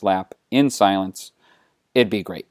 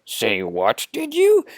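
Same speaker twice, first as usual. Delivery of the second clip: halting Yoda voice with a leaping, questioning end; Yoda impression; slower than usual. Yoda answers Luke's scolding, in a halting Yoda voice. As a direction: play a puzzled little Yoda. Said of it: "And Yoda's like".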